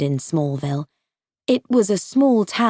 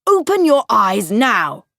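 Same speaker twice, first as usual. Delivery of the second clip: stern father; 3 semitones higher, 5 dB louder than usual. Acted. Father orders scared son.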